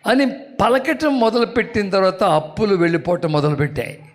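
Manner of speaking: ordinary talk, nothing marked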